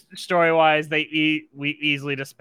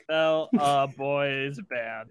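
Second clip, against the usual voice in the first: slow, deliberate typing read; slow dictation; much slower than usual. Shown as a demonstration. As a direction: spell it out slowly as you type. GM types player's line.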